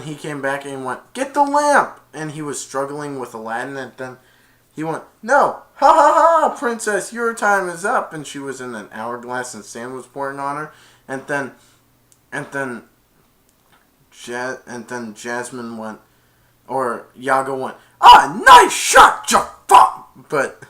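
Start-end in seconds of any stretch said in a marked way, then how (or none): 1.1-2.0 high, loud barked order; villain command. Retold or imitated. Jafar ordering his parrot to grab the lamp, loud high shout. 5.2-8.1 gloating villain. Retold or imitated. Jafar gloating at the trapped princess, loud high evil laugh. 18.0-20.1 very high, loud, slow squawk; parrot cheer. Retold or imitated. Iago cheering his master's shot, slow loud screech.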